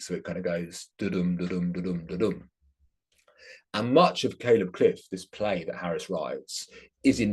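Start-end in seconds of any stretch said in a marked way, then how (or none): 1.0-2.5 even "da-dum" beats, stress on each "dum"; "da-dum" with light "da", weighted "dum"; heartbeat rhythm. Shown as a demonstration. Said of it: "imitates the sound of the heartbeat".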